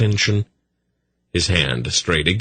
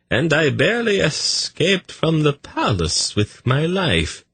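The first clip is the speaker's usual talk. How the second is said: much higher, very slow, fussy eunuch voice; eunuch Tzadi; 9 semitones higher than usual. A narrator's reading. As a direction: lift it up high and drawn out, wary. Tzadi continues.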